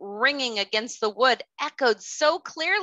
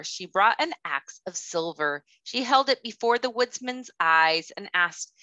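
ordinary delivery

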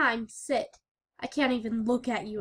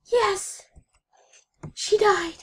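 gleeful cheer; 5 semitones higher, 6 dB louder than usual. Real, not acted. Celebrating dog's death.

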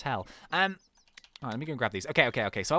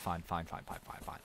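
resigned mutter; 11 semitones lower, 12 dB quieter than usual. Real, not acted. Resigned under breath.

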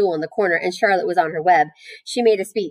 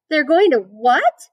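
higher, louder cry of shocked disbelief; screamed question; 8 semitones higher, 6 dB louder, slower than usual. A narrator's reading. Wilbur learns why he is being fattened, screamed in alarm. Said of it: "screamed Wilbur".

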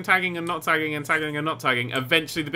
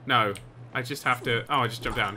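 ordinary talk, nothing marked

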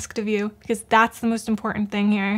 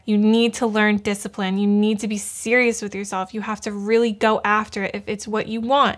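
usual speaking voice

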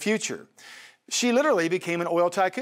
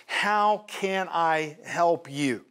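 each word drawn out slowly; slow emphatic; much slower than usual. Shown as a demonstration. Preacher stressing Elisha's question, slow and emphatic. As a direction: lean on every word of "How can I help you?". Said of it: "underline that word in your Bible".

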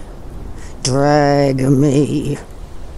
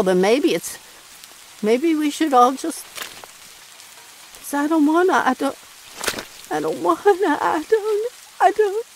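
voice breaking; 12 semitones higher than usual. Acted. Breaking down mid-sentence.

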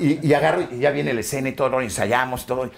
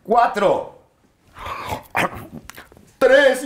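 loud, high director's countdown; countdown called out; 11 semitones higher, 3 dB louder, much slower than usual. Retold or imitated. Crew counting down to a take, called out high and loud. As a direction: call each number out sharply, well spaced.

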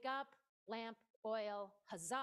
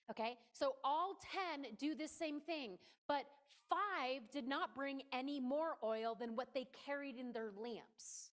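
normal talking voice

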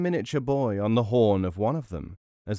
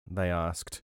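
ordinary delivery

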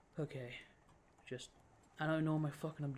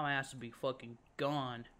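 ordinary talk, nothing marked

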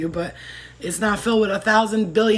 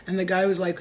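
ordinary talk

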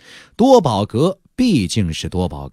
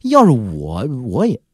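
put-on young cultivator scoffing in a higher voice; resentful, unconvinced; 7 semitones higher than usual. Young cultivator inwardly belittling a famous peer, resentful scoff. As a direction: grumble dismissively at someone else's luck. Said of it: "年轻修士的心中满是不服气".

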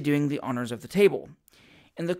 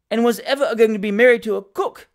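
higher, louder indignant cook; 4 semitones higher, 6 dB louder, faster than usual. A narrator's reading. Cook accuses Job before the kitchen company, indignant. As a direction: throw out the accusation in outrage. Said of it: "said that lady indignantly".

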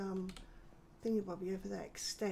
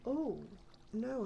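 ordinary talk

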